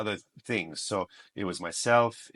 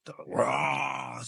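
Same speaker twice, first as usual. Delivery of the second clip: long, higher mock roar; imitated lion roar; 4 semitones higher than usual. Older brother auditioning as a lion, imitated roar. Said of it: "Tapos si Kuya".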